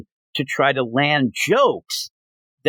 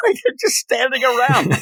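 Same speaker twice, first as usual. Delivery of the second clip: laughing; 8 semitones higher than usual. Real, not acted. Laughing at the goofy fight.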